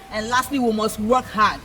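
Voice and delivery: ordinary delivery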